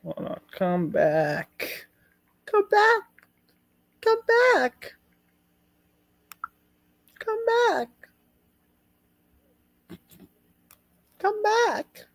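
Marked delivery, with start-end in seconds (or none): 2.5-3.0 falsetto call. Pretend. Falsetto repetition. 4.0-12.1 high, sing-song call to come back; falsetto calling. Pretend. Calling out to departed co-host.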